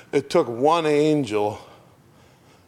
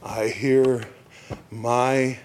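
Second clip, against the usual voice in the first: slow reading fading to a whisper; solemn lyric recital; slower than usual. Acted. Preacher reading the hymn line, hushed at the end. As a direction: let it sink to a hushed whisper.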